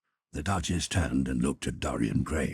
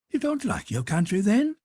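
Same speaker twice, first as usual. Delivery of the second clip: arch, teasing noblewoman, pitched up; high Duchess voice; 6 dB louder than usual. Acted. Duchess challenging Lord Henry, high and teasing. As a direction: play the witty Duchess, high and bright.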